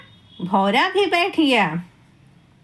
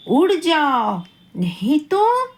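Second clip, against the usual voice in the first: mock threatening bumblebee; a playful warning with a rising end; threat.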